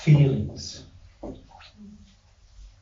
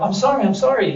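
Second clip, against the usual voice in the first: higher, hurried "I'm sorry" begging; mock pleading apology; 8 semitones higher, 4 dB louder, faster than usual. Shopper begging not to be punched, in a higher, hurried mock plea. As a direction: plead so you won't get punched. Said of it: "pleading with you that you don't punch them".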